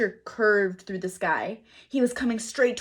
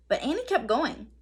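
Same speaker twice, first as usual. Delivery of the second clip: usual speaking voice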